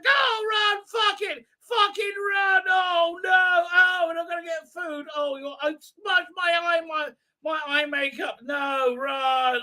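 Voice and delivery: hammed-up high camp panic; shrieking camp Thals. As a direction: squeal in panic while fleeing.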